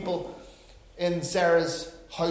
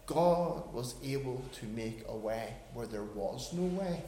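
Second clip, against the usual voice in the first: normal talking voice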